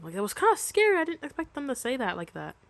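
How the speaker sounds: usual speaking voice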